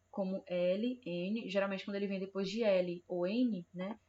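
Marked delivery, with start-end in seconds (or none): none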